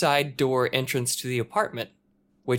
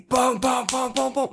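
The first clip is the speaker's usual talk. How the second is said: mock booms; 12 semitones higher than usual. Imitating the banging.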